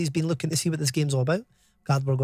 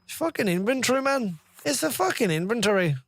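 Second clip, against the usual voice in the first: higher-pitched mock Irish accent; 6 semitones higher than usual. Streamer trying out a word as an Irish person, mock Irish accent. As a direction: put on an exasperated Irishman's accent. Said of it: "how would an Irish person".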